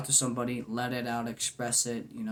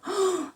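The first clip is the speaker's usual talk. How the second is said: mock gasp; 4 dB louder than usual. Mock surprise at someone smiling.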